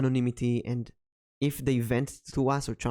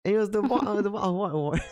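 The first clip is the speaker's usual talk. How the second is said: put-on British accent; 7 semitones higher than usual. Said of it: "A British accent".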